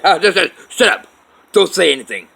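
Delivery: high, very loud interruption; angry retold shout. Dad tells screaming mother to shut up, shouting. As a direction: shout someone down, acting it out.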